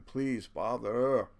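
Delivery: slow, loud pleading cry. Retold or imitated. Someone starving cries to God, slow loud plea. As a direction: stretch out a desperate plea. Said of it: "you'll start crying".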